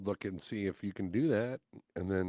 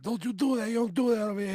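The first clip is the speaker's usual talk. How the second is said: mock screaming; 13 semitones higher, 6 dB louder than usual. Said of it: "just screaming at me".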